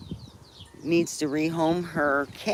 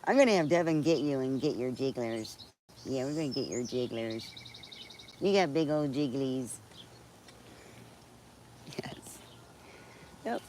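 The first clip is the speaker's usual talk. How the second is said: pet talk; 2 semitones lower, 6 dB quieter than usual. Pretend. Talking to her chicken.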